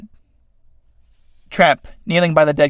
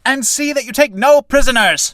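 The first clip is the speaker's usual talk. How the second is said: high, raised battle cry; commanding order; 10 semitones higher, 4 dB louder than usual. Acted. Commander ordering no prisoners taken, high commanding shout. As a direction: order the troops, high and forceful.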